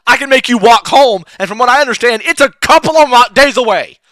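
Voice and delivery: angry threat to the greedy cleric; threatening. Acted. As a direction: lay down the threat.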